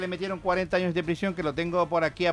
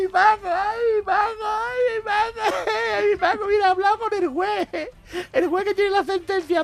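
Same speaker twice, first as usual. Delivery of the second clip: very high, sobbing lament; hammed-up wailing wife. Wife at the prison wailing to Paco about the judge, sobbing high. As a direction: wail his name over and over, hammed-up.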